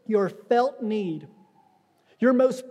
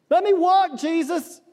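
high, loud plea to be healed; pleading cry; 6 semitones higher, 4 dB louder than usual. Retold or imitated. Paralytic begging Jesus, loud pleading cry. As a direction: beg Jesus out loud to walk.

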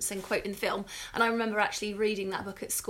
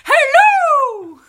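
very high, loud drawn-out hello; shrill hello impression; 18 dB louder than usual. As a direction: shout it up high like a fake lady. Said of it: "Is it like that?".

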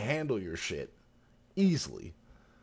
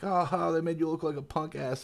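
mocking bandit taunt; 7 semitones higher than usual. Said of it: "it's just like".

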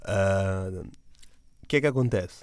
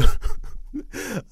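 riso agudo e soprado; 12 semitones higher, 6 dB louder than usual. Real, not acted. Riso no meio da história.

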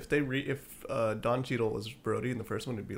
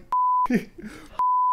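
imitated laugh; 6 dB louder than usual. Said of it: "She said it like that?".

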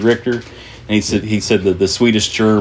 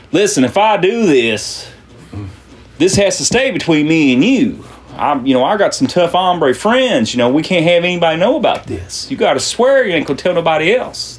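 pitched-up, mildly agitated bargaining; urgent insistence; 8 semitones higher than usual. Acted. Miner swearing the bartender to secrecy, urgent. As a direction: press them to promise, lightly hammed up.

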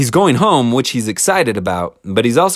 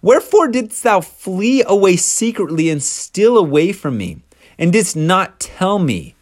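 higher, indignant questioning; livid demand; 6 semitones higher than usual. Retold or imitated. Angry Laban confronting Jacob about fleeing. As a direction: press him like an outraged father. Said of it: "is livid by this point".

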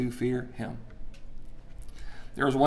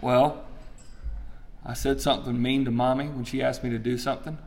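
ordinary talk

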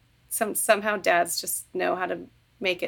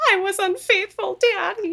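higher, hammed-up wailing confession; imitating a dramatic TV confession; mock melodramatic confession; 11 semitones higher than usual. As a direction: overplay the guilty daughter. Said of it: "she's just".

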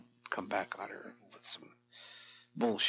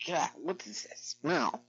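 higher, slow sniffing question in a put-on voice; imitating wife; 6 semitones higher, slower than usual. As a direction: play the wife wrinkling her nose. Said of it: "She'll open the door".

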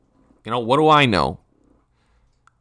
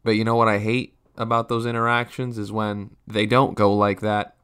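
ordinary delivery

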